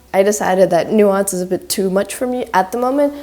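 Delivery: ordinary delivery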